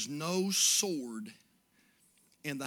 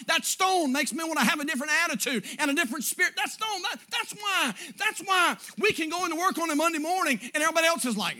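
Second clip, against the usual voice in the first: loud, much higher, rushing pulpit fire; breathless shouting sermon; fervent preaching climax; 10 semitones higher, 10 dB louder than usual. Acted. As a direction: preach it out with rising fervor.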